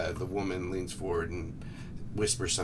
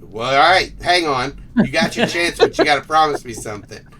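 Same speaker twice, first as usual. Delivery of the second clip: raised, forceful character voice; loud bargaining; 7 semitones higher, 12 dB louder than usual. Acted. Rival bargains over a Pokeball trade, loud and insistent. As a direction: push it out loud and high.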